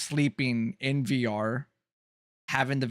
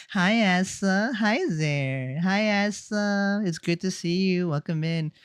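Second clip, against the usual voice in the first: higher, drawn-out femmy greeting; 4 semitones higher than usual. Shown as a demonstration. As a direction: greet in a slow, put-on femmy voice. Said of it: "my Femi voice".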